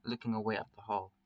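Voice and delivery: ordinary delivery, nothing marked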